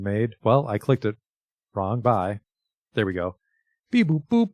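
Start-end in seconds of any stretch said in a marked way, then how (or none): 3.9-4.5 high, loud robot beeps. Pretend. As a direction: play a little robot. Voices robot hand gif.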